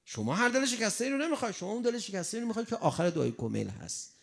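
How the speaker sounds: ordinary delivery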